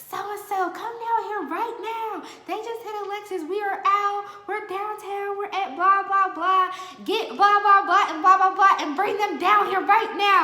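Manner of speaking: hammed-up frantic call for backup. On the phone to the homies after the hit, dramatic high urgent summons. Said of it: "way more dramatic".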